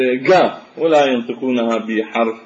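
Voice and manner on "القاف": القاف said as gaaf, the qaf voiced like a hard g. Shown as a demonstration. Lecturer showing how Iraqis say the letter, hard g. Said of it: "ينطقونها بحرف ال".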